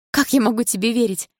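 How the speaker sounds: high, loud, weeping reproach; tearful outburst. Acted. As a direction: cry it out at him. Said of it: "чувствуя, как заструились по щекам слёзы".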